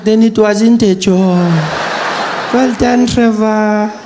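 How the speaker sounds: a hint of a proud, slow grandmother; lightly voiced granny. Granny dismissing his old work, lightly voiced. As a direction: say it like a satisfied granny. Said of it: "She's like".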